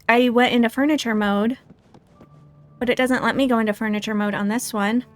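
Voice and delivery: ordinary delivery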